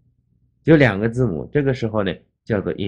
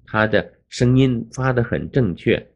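ordinary talk

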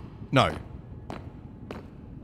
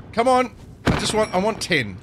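ordinary talk, nothing marked

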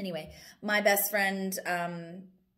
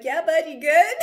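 much higher and louder, imitating a buddy calling over; imitating George; 10 semitones higher, 12 dB louder than usual. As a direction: call out "you good?" in a mock macho buddy voice. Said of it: "and he's like".

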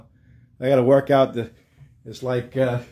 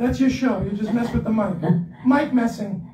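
high, sweet angel voice; little angel voice; 6 semitones higher than usual. Real, not acted. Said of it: "with my voice like this all the time".

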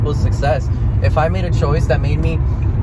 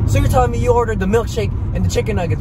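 high, fast condescending voice; mocking the worker; 8 semitones higher, faster than usual. Retelling the worker's attitude, high mocking voice. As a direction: mimic the smug cashier. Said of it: "be like".

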